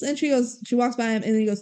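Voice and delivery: usual speaking voice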